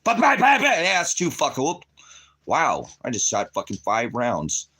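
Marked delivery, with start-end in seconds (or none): 0.0-0.8 loud, high bang-bang imitation; yelled mock gunfire; shouted gunshot noises. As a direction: blast out the shots like a gun going off.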